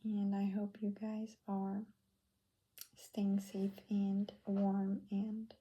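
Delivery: ordinary talk, nothing marked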